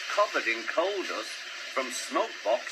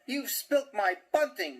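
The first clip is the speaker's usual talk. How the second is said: higher, whiny moan; 6 semitones higher, 3 dB louder than usual. Acted. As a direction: complain miserably. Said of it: "moaned Percy".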